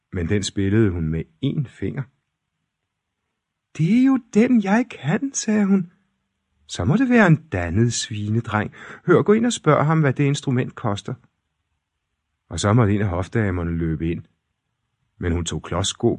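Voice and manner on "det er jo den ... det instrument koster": high, bright-voiced princess; delighted fairy-tale princess, high and loud. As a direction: pitch the princess way up and excited.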